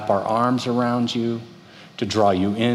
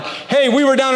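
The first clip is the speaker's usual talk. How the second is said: high explaining; 14 semitones higher, 4 dB louder than usual. Retold or imitated. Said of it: "and I'm like".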